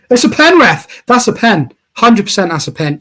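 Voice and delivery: high, loud, sure it's a foul; excited penalty claim. Real, not acted. Fan shouting at the ref that it's a penalty. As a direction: yell for the penalty at the screen.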